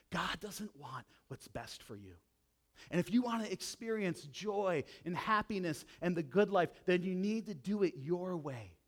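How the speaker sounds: ordinary talk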